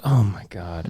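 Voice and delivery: low, soft, defeated imitation; mock weary wife. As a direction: murmur it low like an embarrassed wife. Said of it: "Look at his wife. She's like".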